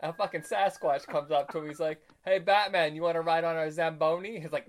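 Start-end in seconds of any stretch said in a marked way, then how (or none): 2.2-4.4 loud, raised mock invitation; hailing someone. Retelling a Sasquatch hailing Batman at the rink, loud friendly call. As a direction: call out an offer in a comic retelling.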